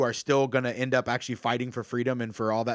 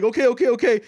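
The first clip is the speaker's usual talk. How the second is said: flustered compliance; 8 semitones higher, 5 dB louder, faster than usual. Retold or imitated. Acting the panicked, hurried crowd.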